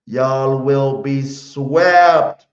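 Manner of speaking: loud and very drawn out, SWEPT stretched long; big, slow, booming-volume declaration; emphatic announcement. Shown as a demonstration. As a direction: proclaim it slowly so every rival fan hears. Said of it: "I want y'all to hear this".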